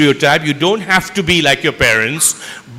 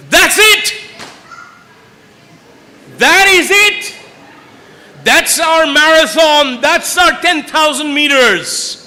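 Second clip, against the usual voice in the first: high, loud triumphant cry; rising to a thrilled, loud climax; excited shout; 9 semitones higher, 6 dB louder than usual. Real, not acted. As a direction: shout it out like the winning answer. Teacher hears the answer he wanted.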